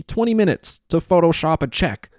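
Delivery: usual speaking voice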